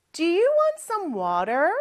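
ordinary talk, nothing marked

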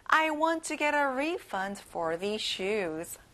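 very slow, carefully spaced sentence; slow model sentence. Shown as a demonstration. Teacher modelling a refund request slowly. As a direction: say it slowly to be memorised. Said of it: "한번 따라해보세요".

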